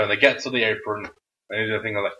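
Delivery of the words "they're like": ordinary delivery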